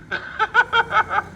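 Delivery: a high burst of mocking laughter. Real, not acted. Laughing high at the news he mocks.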